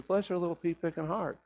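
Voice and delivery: a soft, high, tender quote of a forgiving grandmother; sweet granny blessing. Retold or imitated.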